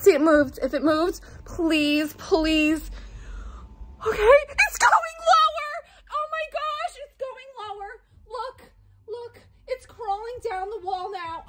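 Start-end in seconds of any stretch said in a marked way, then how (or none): 4.1-5.8 high panicked cry; real startled panic; alarmed. Real, not acted. Spider moves toward her. 6.1-11.4 high, hushed, tearful alarm; soft, whimpering fear; breathless horror, kept quiet and high; frightened. Real, not acted. Watches spider crawl down.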